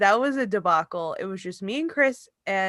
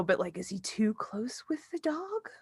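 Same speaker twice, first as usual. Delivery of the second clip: mock whisper of a secret worry; whispered mom; 10 dB quieter than usual. Mom whispers her suspicion to her daughter, mock whisper. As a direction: breathe it like a guilty secret. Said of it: "And she goes".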